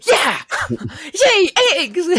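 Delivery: high, hammed-up squeal of joy; gleeful cheer. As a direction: play a kid thrilled about eggs. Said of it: "I was like".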